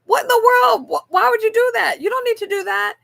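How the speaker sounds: high, loud astonished protest; shocked disbelief. Retold or imitated. Reacting to a coworker buying her lunch, high astonished protest. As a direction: cry out in total disbelief. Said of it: "And I was like".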